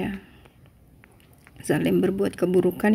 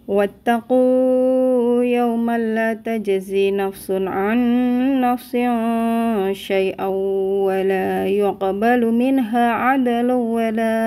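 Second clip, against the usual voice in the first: Quran recitation; 2 semitones higher than usual. Acted. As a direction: recite the verse with measured care.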